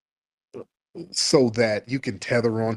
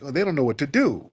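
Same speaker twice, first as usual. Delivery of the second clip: usual speaking voice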